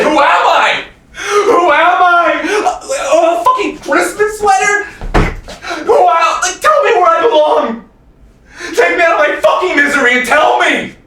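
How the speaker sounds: high, loud, desperate cry to God; anguished screaming. Acted. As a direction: scream your despair at the heavens. Crying out to God in despair.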